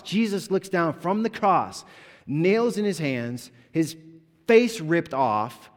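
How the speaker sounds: loud, high, emphatic sermon delivery; impassioned crucifixion preaching; full-force preaching. Real, not acted. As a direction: hammer each suffering home from the pulpit.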